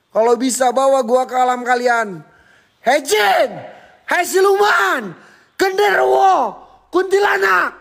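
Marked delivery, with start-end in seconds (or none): 2.9-7.7 high, slow shout at the spirits; calling out spirits. Real, not acted. Vlogger calls the ghosts by name, shouting at them.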